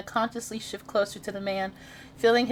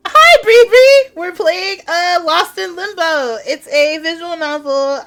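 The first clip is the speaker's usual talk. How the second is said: excited greeting; 8 semitones higher, 13 dB louder, slower than usual. Real, not acted.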